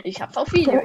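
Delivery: amused self-defence, laugh breaking in; giggly insistence; laughing protest. Real, not acted. Laughing while insisting on his proof.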